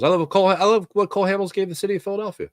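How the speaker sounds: ordinary delivery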